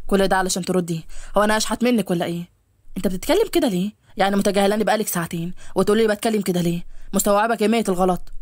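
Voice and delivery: low male voice, reproachful; annoyed young man. Acted. (صوت شاب منخفض يعاتب)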